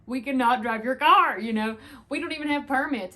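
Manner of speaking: emphatic protest. Retold or imitated. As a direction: protest loudly, re-enacting a kid's disbelief. Retold protest at driver's offer.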